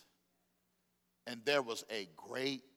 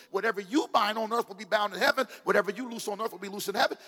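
louder, rushing second line; preaching fervently; 6 semitones higher, 6 dB louder, faster than usual. Acted. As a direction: drive it home to the congregation. Preaching at full force.

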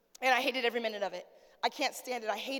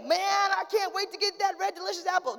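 higher and louder, hammed-up excitement over an apple; mock eager craving; 5 semitones higher, 3 dB louder than usual. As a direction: play the absurd eager craver, half-joking.

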